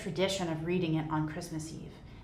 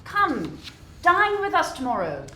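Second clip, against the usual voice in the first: higher, friendly plea; cheery nephew pleading; warm coaxing; 6 semitones higher, 5 dB louder than usual. Acted. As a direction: invite him gently.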